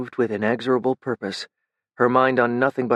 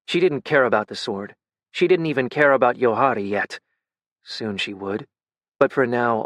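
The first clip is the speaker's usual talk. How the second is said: ordinary delivery, nothing marked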